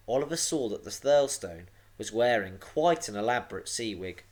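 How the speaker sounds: usual speaking voice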